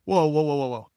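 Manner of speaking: urgent but mildly acted plea; urgent intervention. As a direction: jump in to halt a fight. Diplomat stepping in before a fight.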